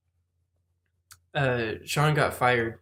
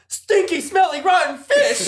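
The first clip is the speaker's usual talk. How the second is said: high-pitched, louder mock outburst; ranting shop owner; 4 dB louder, slower than usual. Employee mimics the shop owner finding rotten fish, shrill mock outburst. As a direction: imitate the owner squawking in disgust. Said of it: "coming out of the front of the store going".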